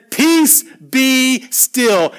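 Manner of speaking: high, loud command to the sea; commanding rebuke. Acted. Jesus waking and ordering the storm to calm, loud. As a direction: still the storm with one firm word.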